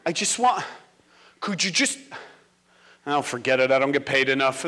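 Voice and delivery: coax, then give up, exhausted; defeated teacher. Retold or imitated. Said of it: "the poor teacher's like".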